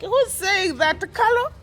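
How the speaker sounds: high, loud incredulous laughing question; laughing disbelief. Retold or imitated. Mocking Carol to viewers, laughing high. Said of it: "I was like".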